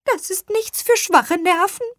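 very high hen voice; clucky, high-pitched caution; warning hen. A narrator's reading. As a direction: warn in a high chicken squeal. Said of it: "warnte Schneeweißchen".

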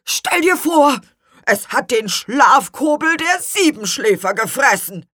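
high, loud, alarmed report; agitated. A narrator's reading. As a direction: blurt out the shocking news. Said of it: "aufgeregte Stimme hören".

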